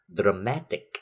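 "dramatic" punched on the middle syllable, MAT; stress on MAT. Shown as a demonstration. Teacher drilling -ic words, MAT stressed in "dramatic". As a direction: lean on MAT in "dramatic", dra-MAT-ic. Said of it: "sẽ được nhấn âm ngay phía trước".

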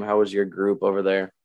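ordinary talk, nothing marked

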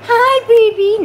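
high, loud puppy greeting. Real, not acted. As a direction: coo hi at the puppy.

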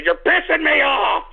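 angry outburst. Acted. Host exploding at his prank callers, angry yell. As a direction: burst out yelling in anger. Same warning repeated, now exploding.

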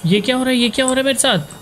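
high-pitched, bewildered panic; alarmed disbelief. Real, not acted. Startled by events in horror game.

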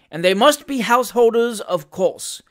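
high, loud proclamation; aloud, with great dignity. A narrator's reading. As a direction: lay it down as law. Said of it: "said the magistrate aloud, with great dignity".

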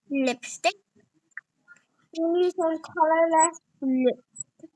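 ordinary talk

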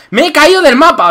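surprised. Real, not acted. Fell off map.

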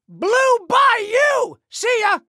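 high, loud taunting send-off; taunting shout. Acted. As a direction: holler it high as you speed past. Taunting shout while racing past.

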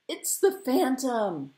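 high, drawn-out cry; excited scream. Acted. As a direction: cry out the long-awaited news. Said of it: "he screamed at last".

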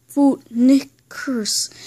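deliberate, word-by-word repeat; insistent, spaced-out statement; slow clarification. Pretend. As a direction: separate each syllable, falling at the end.

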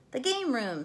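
loud, high shout from the crowd. A narrator's reading. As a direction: call out an answer eagerly. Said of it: "someone shouted".

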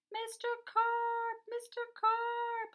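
very high, long-drawn call; worried storybook shout; yelling. A narrator's reading. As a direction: holler the name across water, holding the last word. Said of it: "I yelled".

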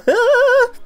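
very high loud cackle; shrieking laugh. Real, not acted. Cracking up at own pun, high shrieking laugh.

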